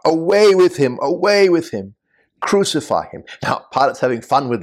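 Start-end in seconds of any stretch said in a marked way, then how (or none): 0.1-3.2 raised-pitch, louder crowd cry. Acted. The crowd answers Pilate in verse fifteen, crying out. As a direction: urgently call out the mob's demand. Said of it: "They respond in verse fifteen by crying out".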